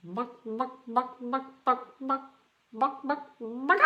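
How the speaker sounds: high, clucking "buck buck" like a chicken; Tom Walsh chicken impression. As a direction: cluck it out high like a hen, imitating a friend's party trick. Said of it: "I'll try to do my best Tom Walsh impression".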